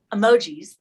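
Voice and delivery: a word breaking into a real laugh. Real, not acted. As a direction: let the laugh spill over the word. Amused at emoji ratings.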